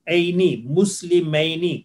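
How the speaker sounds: slow مسلمینی with a long "ee" before the final "nee"; sound "eeni". Shown as a demonstration. Teacher models the second dual ending for students, slow. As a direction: stretch اینی out, ending on a long ee. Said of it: "sound ہوگی".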